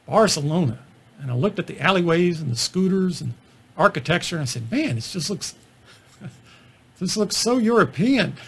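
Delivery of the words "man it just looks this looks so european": chuckling through a naive remark; laughing at own remark. Retold or imitated. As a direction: laugh as you say it, amused at yourself.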